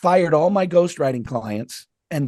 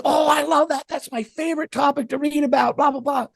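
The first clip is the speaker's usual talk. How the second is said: much higher, gushing enthusiasm; gushing fan; 13 semitones higher than usual. Retold or imitated. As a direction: gush like a starstruck fan. Voicing stranger gushing over topic.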